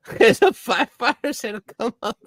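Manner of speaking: can barely get it out for laughing; giggling through the punchline; laughing through words. Real, not acted. Laughing while telling the story.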